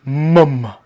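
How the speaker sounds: softly voiced African-accented imitation; little African voice. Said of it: "he said it in a little African voice".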